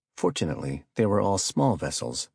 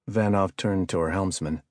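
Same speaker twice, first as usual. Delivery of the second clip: ordinary delivery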